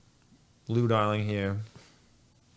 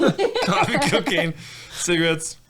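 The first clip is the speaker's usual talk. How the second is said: laughing; 7 semitones higher, 8 dB louder than usual. Real, not acted. Listing vices through laughter.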